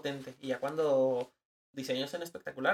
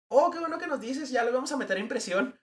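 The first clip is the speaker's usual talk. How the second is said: high-pitched, louder mock-grateful reply; cheery company rep; 10 semitones higher, 6 dB louder than usual. Ad company answering the designer just before printing, chirpy relief.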